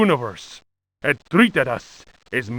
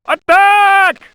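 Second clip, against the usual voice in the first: hammy battle cry, far higher and louder; bad acting; 8 dB louder than usual. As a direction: bellow it as a stiff order. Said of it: "Captain Cortez cried".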